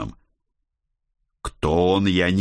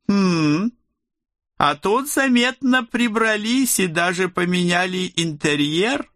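higher, satisfied male god voice; a touch of envious approval; завистливое удовлетворение; 12 semitones higher than usual. Acted. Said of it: "с лёгким оттенком завистливого удовлетворения заметил". (с лёгкой завистью и удовлетворением)

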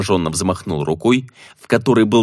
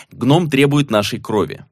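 ordinary talk

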